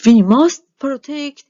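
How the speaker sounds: loud urgent command; rally the town loudly; mayor shouts orders at the fleeing townspeople; mayor shouting. A narrator's reading. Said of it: "shouted the mayor".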